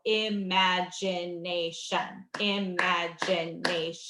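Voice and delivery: "Imagination" slowly, one syllable at a time. Shown as a demonstration. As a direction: clap out im-ag-i-na-tion slowly. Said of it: "every time your mouth drops, you clap a syllable".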